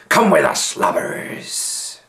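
pirate rounding up the prisoners; gruff pirate. A narrator's reading. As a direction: bark it like an old sea dog. Pirate leader orders prisoners.

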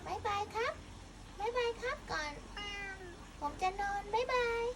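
high cute cat voice goodbye; voicing the cat. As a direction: say bye in a tiny high voice.

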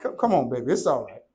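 soft, low, tender reassurance; demonstrating a calm, loving parent; said in love. Shown as a demonstration. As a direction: soothe a child gently. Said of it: "Say it in love".

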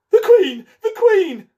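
high, loud warning cry; alarmed call. Acted. Gardener Five spotting the Queen coming, loud alarmed shout. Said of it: "called out".

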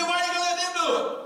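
high, loud, fast whine; mock indignant son; whiny son. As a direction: rattle off the complaint.

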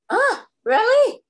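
high, slow doubtful "really?"; mock surprise. Tutor questioning child's wrong colour, mock surprise. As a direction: act surprised to prompt a correction.

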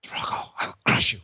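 whispered Russian boxer menace; low, breathy Drago threat; Ivan Drago voice. Retold or imitated. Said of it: "It's got Ivan Drago".

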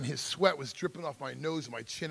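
ordinary talk

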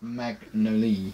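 "Magnolia" slow and soft, ending on "lee"; Magnolia as "mag-no-lee". Shown as a demonstration. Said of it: "You called it Magnolia".

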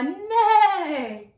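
high horse-like neigh; zebra bray. Shown as a demonstration. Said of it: "Braying like a zebra?".

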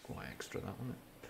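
breathy whispered aside to the listener; confiding unvoiced aside. Real, not acted. Muttered aside.

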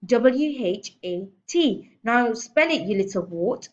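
loud, slow emphatic spelling; shouting. A narrator's reading. Headmistress drills a dangling boy in spelling, shouting. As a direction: spell it out forcefully, then order him. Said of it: "The Trunchbull was shouting".

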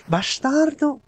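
sudden high angry yell; explosive italian cursing. Real, not acted. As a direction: spit the curse out in frustration. Gamer frustrated by enemy.

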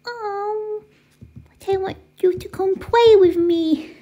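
put-on whiny, high toddler pleading; high babyish whine. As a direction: beg like a disappointed little one. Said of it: "Why are you talking like a baby?".

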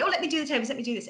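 imitating her own keen offer to help at a conference; overly helpful. Arriving early at a conference, offering to set up tables, eager and high. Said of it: "I always seem overly helpful".

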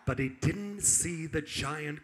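hushed suspense. Acted. Storyteller hushing the children before danger, soft and low. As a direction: drop the voice for the crocodile.

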